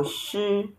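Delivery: "Shi" held long and level; flat tone on Shi. Shown as a demonstration. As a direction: stretch "Shi" on one even pitch. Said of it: "that just goes flat on the letter".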